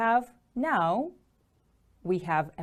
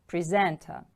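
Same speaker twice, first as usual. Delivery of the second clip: "Presenter" with the stress on SENT; second-syllable stress; 2 semitones lower than usual. Shown as a demonstration. Teacher models the word for repetition, "Presenter" stressed on SENT. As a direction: lean on the middle of "Presenter": pre-SENT-er. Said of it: "The stress is on the second syllable".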